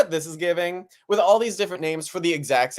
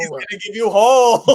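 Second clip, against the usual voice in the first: laughing exclamation; 6 semitones higher, 8 dB louder, faster than usual. Real, not acted. Joking misheard word, loud.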